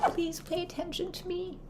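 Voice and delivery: high, soft whimpering plea; high, soft, slow begging voice; pleading. Retold or imitated. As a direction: beg weakly like a needy child.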